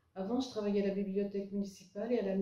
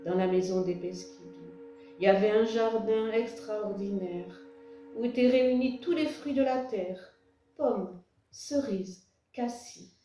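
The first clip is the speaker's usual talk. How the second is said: louder, projected poem recitation with measured pauses; poem reading; 5 dB louder than usual. Acted. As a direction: read your poem aloud, line by line, lifting the refrains. Reads own poem to audience. (lecture de poème à voix haute, posée et projetée)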